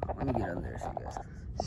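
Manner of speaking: soft breathy whisper. Real, not acted. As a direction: whisper it to yourself. Aside while getting under the car.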